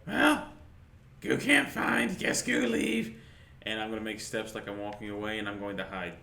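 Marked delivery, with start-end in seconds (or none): 0.1-3.2 high, slow, simple-minded creature. Acted. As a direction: speak like a dim little creature, high and slow. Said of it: "I'm just gonna go".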